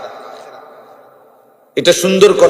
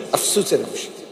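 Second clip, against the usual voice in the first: much softer, trailing regretful repeat; hushed regret; 2 semitones lower, 10 dB quieter than usual. Real, not acted. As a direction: let the lament sink away quietly.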